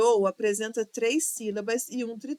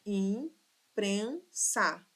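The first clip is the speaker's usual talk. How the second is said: imprensa sílaba por sílaba; much slower than usual. Shown as a demonstration. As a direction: break imprensa into three slow syllables. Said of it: "Três sílabas". (imprensa silabada, com pausas)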